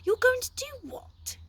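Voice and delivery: very high shocked gasp; can't believe it, gasping. A narrator's reading. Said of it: "gasped Flabbergast".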